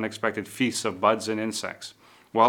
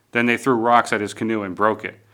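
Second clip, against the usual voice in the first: ordinary delivery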